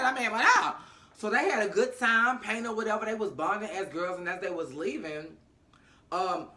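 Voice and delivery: ordinary delivery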